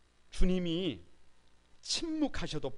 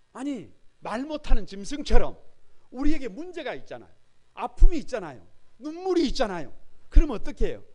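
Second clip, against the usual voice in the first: higher and much louder impassioned sermon; preaching at full force; 8 semitones higher, 12 dB louder than usual. Acted. Pastor urging the congregation to cry out, full force.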